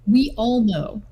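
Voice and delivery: normal talking voice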